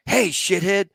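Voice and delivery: loud, high mock-angry yell; angry demand. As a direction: chew out the navigator. Gunny confronts the navigator, retold.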